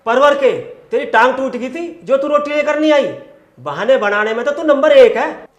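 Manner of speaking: loud, high-pitched sarcastic scolding; angry scolding. Acted. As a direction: tear into the wife's excuses loudly. Angry husband scolds his wife.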